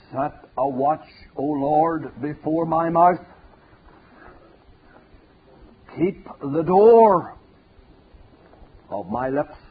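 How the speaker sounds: loud, ringing, slow scripture reading; a preacher booming out a psalm; preaching scripture aloud. Acted. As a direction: proclaim the verse to the back of the hall. Scripture quoted from the pulpit.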